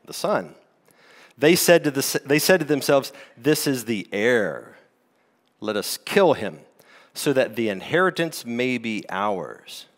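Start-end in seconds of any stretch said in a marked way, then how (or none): 3.4-9.9 lower, scheming tenants. Acted. Tenants plotting murder.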